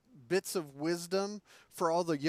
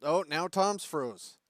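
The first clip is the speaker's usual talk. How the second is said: ordinary talk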